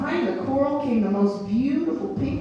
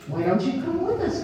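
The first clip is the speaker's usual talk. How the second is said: raised-pitch invitation; eager cry; 6 semitones higher than usual. A narrator's reading. Dottie inviting the sea slug along, eager cry. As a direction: lift the voice into a friendly offer. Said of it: "cried Dottie".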